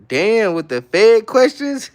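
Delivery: high, drawn-out incredulous tease; mock disbelief. Real, not acted. Streamer teasing a chatter over a nosy question, mock disbelief.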